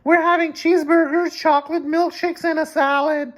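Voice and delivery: put-on very high squeaky boy voice; squeaky David voice. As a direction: squeak it out like a cartoon kid. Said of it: "said David".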